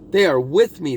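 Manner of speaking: normal talking voice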